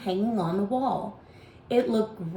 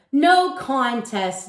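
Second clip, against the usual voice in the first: smug taunt; 5 semitones higher, 6 dB louder, much slower than usual. A narrator's reading. Darren taunting the boys.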